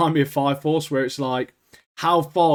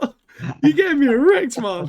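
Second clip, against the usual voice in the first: high, loud incredulous mock repeat; mock disbelief; 12 semitones higher, 4 dB louder than usual. Teammate repeating the villain's line, high hammed-up disbelief. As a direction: parrot it in outraged disbelief, hammed-up. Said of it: "He was like".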